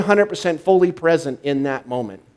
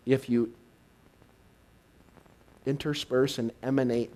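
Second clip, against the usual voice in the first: soft spiritual address; 3 semitones lower, 9 dB quieter than usual. Acted. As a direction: let each word settle gently.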